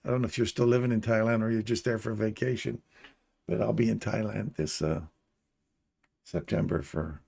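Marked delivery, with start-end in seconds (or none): none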